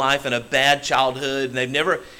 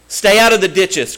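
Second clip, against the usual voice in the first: louder repeat; 6 semitones higher, 7 dB louder than usual. Real, not acted.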